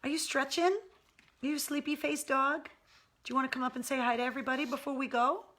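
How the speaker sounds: high, sing-song coaxing of a pet. Real, not acted. Owner calling her pug to come get the monkey toy, high coaxing baby talk. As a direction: coax the sleepy pug over with a toy.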